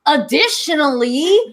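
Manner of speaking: ordinary delivery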